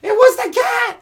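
high, loud shouted excuse. Acted. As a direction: yell it was the cat. Acted quote, high loud shout.

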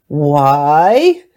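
a loud, booming roar of a single word; roaring dragon. A narrator's reading. Angry dragon roars back at the wise woman, loud. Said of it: "he roared".

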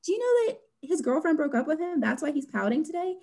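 high-pitched retold gossip; gossipy disbelief. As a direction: act out scandalized news-sharing with a colleague. Acts out gossiping to another teacher.

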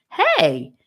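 an excited cry of protest; exclaimed. A narrator's reading. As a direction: burst out in protest. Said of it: "he exclaimed".

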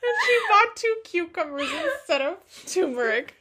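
words squeezed out through laughter; laughing. Real, not acted. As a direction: say it while still cracking up.

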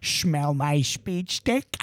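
low, slow cartoon dad with a slurred lisp; lightly lisped lecherous purr; Peter Griffin impression. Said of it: "I say just like that".

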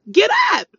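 sudden loud yell of get up; furious command shouted high. Real, not acted. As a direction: bark it out at full force. Ranting at lazy people.

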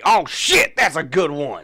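high, loud burst of self-delight; hammed-up excited discovery; mock eureka. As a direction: exclaim like you just struck gold. Acting saying's inventor pleased with idea.

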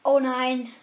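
loud, dismayed cry; loud groan of dismay. Real, not acted. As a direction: cry out in shock. Imposter friend died.

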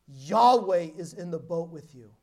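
forceful. Retold or imitated. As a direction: declare it like a strong prayer. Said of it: "she said it kind of forcefully".

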